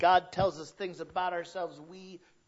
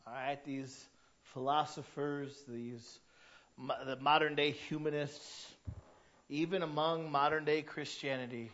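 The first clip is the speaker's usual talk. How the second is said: ordinary delivery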